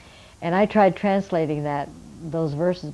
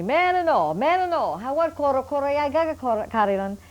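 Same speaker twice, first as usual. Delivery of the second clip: put-on raised, higher old man's call out to Marilyn; old village man calling; 7 semitones higher than usual. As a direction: call across the village like an elder. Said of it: "And he calls to me".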